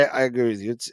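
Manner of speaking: ordinary talk, nothing marked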